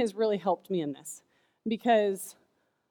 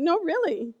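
reassuring mom; 6 semitones higher than usual. Retold or imitated. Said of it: "I said".

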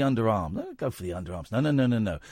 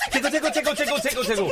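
giggly tickle attack; 9 semitones higher, much faster than usual. Pretend. Tickling again, freestyle.

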